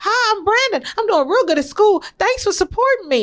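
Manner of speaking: put-on high-pitched friendly greeting, as her eager child; imitating her son. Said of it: "he was".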